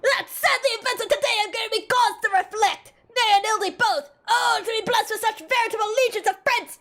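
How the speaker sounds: high, declaimed hammy lament; theatrical warrior character. Acted. Warrior lamenting his lost friend, grandly melodramatic. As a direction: mourn grandly, as if to the back row.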